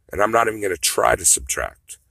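ordinary talk, nothing marked

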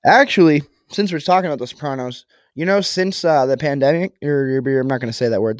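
ordinary delivery

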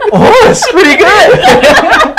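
high, slow mock-impressed; laughing impression of Roy; imitating friend. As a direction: stretch it out, fake impressed. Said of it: "he'd be like".

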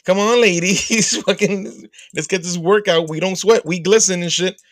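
high, loud, perky troop-leader voice; mock a chirpy scout leader cheering her girls on; prissy Troop Beverly Hills leader. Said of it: "fucking Troop Beverly Hills and shit".

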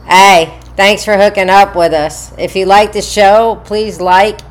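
loud, bright outro read; podcast sign-off. Acted. Host reads the outro to the listeners, loud announcer voice.

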